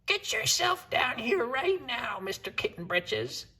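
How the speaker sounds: high, slow scolding mother voice; bossy mama cat. Acted. Mother cat ordering her sleepy son downstairs, high and bossy. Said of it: "ordered Mama Junebug Jones".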